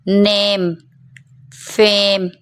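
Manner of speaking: नेम and फेम with long "ey"; drawn-out vowel. Shown as a demonstration. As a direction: stretch नेम, then फेम, on a level tone. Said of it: "थोड़ा खींच कर पढ़ेंगे".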